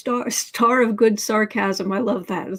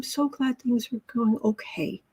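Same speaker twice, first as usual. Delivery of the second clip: soft whispered encouragement; whispering; 6 dB quieter than usual. Real, not acted. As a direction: whisper it gently. Comforting a frustrated viewer.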